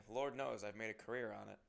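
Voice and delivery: ordinary talk, nothing marked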